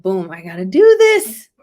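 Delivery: loud burst of excitement; excited. Retold or imitated. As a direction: punch it out, fired up. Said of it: "And I was like".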